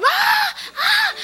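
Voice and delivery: high, hammed-up screams of a frightened woman; mock panicked scream. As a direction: scream like she's terrified in the water. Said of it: "she's just screaming the whole time".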